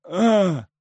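high pained cry; a weak startled groan; pained gasp. Acted. Избитый Сенька откликается. (болезненный вскрик)

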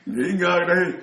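amused plea with a chuckle in it; laughing quote. Retold or imitated. Monks begging him to take a rest, retold laughing. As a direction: retell the brush-off through a laugh.

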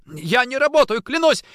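high, loud frightened oath; desperate denial. Acted. Orlov swearing innocence to his attacker, desperate denial. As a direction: plead innocence, pitch climbing steeply.

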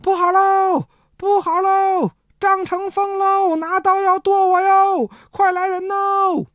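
high, slow cries for help; panicked shouting. Acted. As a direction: yell it out like a frightened servant calling the house. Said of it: "刘安喊上了". (慌张地高声喊叫)

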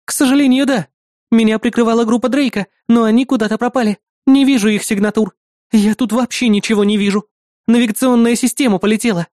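high-pitched woman reporting over comms; female fighter. Acted.

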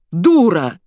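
loud angry insult; acted furious shout; raging. A narrator's reading. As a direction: hurl the word in fury. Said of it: "бушевал Добров".

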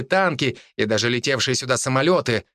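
ordinary delivery, nothing marked

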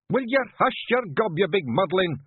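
high, irritated Scots bellow; shouting. A narrator's reading. Clan chief shutting up a wailing clansman, shouting. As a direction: shout him into silence. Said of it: "shouted Rob Anybody".

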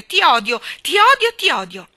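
high, very loud angry shouting; furious outburst. Acted. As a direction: shout it in a child's tantrum. Enraged child shouts her hatred.

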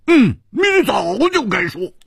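high, insistent; high, pushy agreement; urging. Acted. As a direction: press her to talk. Hu Tiehua urging.

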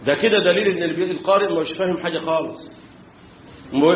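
normal talking voice

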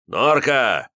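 loud call with a smirk; smirking shout. A narrator's reading. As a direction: shout her name with a grin. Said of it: "с ухмылкой крикнул Ливер".

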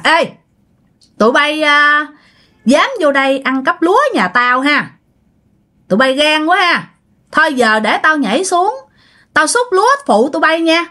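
high, eerie ghost voice taunting thieves; ghostly woman mocking. Ghost of bà Hai taunting the rice thieves, high and eerie. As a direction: voice her as a mocking ghost, pitched well up. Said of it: "Bắt đầu cười hả hả hả lên".

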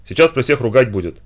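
ordinary delivery

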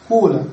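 قول with a long oo, qūla; damma kept. Shown as a demonstration. Teacher showing the allowed variant, قول with long oo. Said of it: "نتركها كما هي ولكن لا نكسرها".

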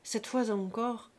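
usual speaking voice

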